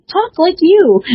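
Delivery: high, playful inner voice; the brain's command. Retold or imitated. Her brain urging her to copy the aunt, in a high playful voice. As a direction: chirp it like a mischievous inner voice. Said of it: "my brain says".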